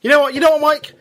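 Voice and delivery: high, loud exclamation; excited. Real, not acted. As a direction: burst out excitedly. Excited outburst to co-host.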